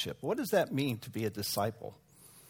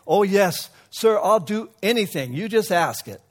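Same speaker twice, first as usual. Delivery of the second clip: eager yes; 6 semitones higher, 7 dB louder than usual. Acted. Alexander eagerly agrees.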